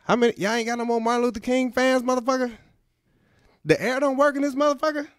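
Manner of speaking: high, fast mock Jackson complaint; Samuel L. Jackson. Comic voicing the actor sweating in old church, high and fast.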